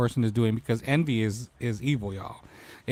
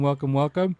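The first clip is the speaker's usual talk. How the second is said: ordinary delivery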